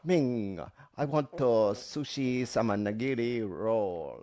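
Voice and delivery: slow, deliberately clumsy mock Japanese accent; badly done Japanese accent. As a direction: drag it out in a fake Japanese accent.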